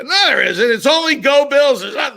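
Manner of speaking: put-on high, loud drunken protest; loud indignant drunk; angry drunk. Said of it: "an angry drunk".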